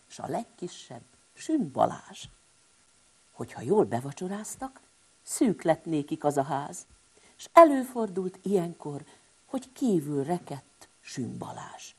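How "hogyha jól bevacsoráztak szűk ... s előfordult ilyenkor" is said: plain reading voice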